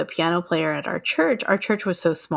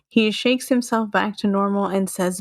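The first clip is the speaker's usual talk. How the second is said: normal talking voice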